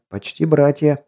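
ordinary delivery

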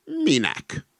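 high-pitched puzzled question; astonished. A narrator's reading. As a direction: ask in high-voiced wonder. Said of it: "csodálkozott a róka". (magas hangú csodálkozás)

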